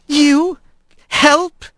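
high, incredulous double question; scornful disbelief. Acted. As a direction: scoff at the very idea. Scornful mouse doubts Rat's offer.

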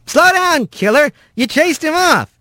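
high, excited calming-down; high-voiced, hurried reassurance; urgent Rat. A narrator's reading. Rat restrains raging Bobcat.

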